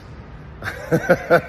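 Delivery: breathy laugh at his own impression; a fond laugh after the story. Real, not acted.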